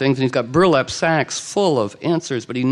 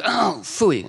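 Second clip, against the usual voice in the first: high-pitched, mock-frustrated outburst; thwarted exclamation; 6 semitones higher than usual. The enemy loses to Arthur's answer, thwarted exclamation. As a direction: pitch it up like a beaten villain giving up.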